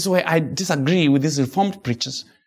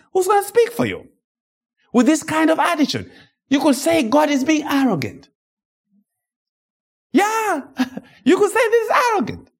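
fervent preaching; faster than usual. Real, not acted. Preacher driving the point home to his congregation, fervent high pitch. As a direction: pitch shoots far higher, voice strained with fervor.

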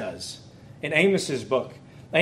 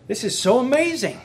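thrilled gush, pitched higher; excited exclamation, higher; 7 semitones higher than usual. Retold or imitated. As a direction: burst out in delight at the text. Retelling his excitement in the study.

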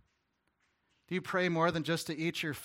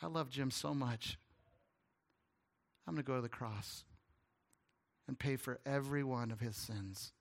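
tender; 4 semitones lower, 6 dB quieter than usual. Retold or imitated. Jesus speaking tenderly.

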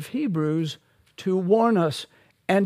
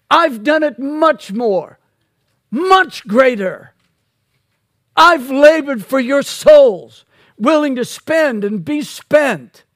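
louder, higher insistent voicing of Paul; emphatic Paul; 5 semitones higher, 7 dB louder than usual. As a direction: press the claim like Paul defending himself. Voicing Paul's boast.

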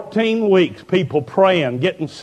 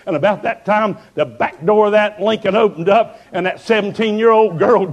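normal talking voice

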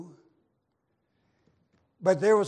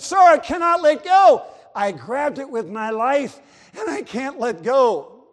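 much higher, loud, pleading cry; desperate plea; 6 semitones higher, 9 dB louder than usual. Acted. Sailor cries out to the captain that he cannot let go. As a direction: beg as if your life hangs on it.

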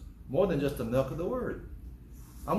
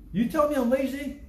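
mock indignant; 9 semitones higher, 4 dB louder than usual. Offended listener acted.

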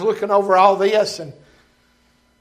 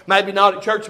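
normal talking voice

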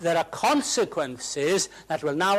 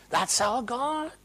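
slower echo; 9 semitones higher, slower than usual. Acted. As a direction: let it settle, slower.

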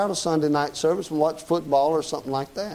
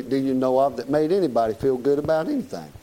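ordinary talk

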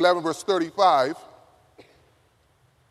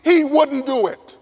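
high, slow, emphatic declaration; impassioned preaching; 7 semitones higher, 4 dB louder than usual. Real, not acted. Preacher assures college students of God's love, impassioned. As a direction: answer the plea with full conviction. Preacher's climax answering the acted plea.

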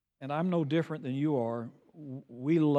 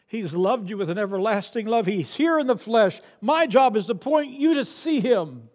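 louder, emphatic pulpit exhortation; forceful preaching; 5 semitones higher, 7 dB louder than usual. Real, not acted. Preacher pointing his congregation to Christ, forceful preaching. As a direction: drive the warning home to the congregation.

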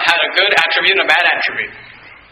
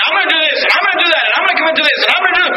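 high, flippant run of promises; 8 semitones higher, much faster than usual. Acted. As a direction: rattle off promises carelessly in a high sing-song. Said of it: "just flippantly and say".

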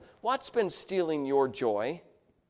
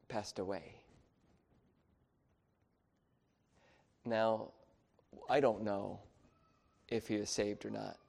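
hushed, low, somber telling; soft and subdued with regret; 4 semitones lower, 6 dB quieter than usual. Real, not acted. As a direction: confess it quietly, leaving long pauses. Regretful memory of neighbor's death.

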